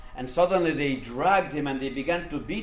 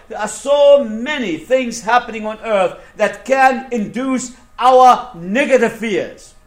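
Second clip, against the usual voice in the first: high, driving sermon list, each item hammered; fervent preaching; 7 semitones higher, 11 dB louder than usual. Acted. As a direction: pile up the causes with insistent force. Preaches a mounting list of causes.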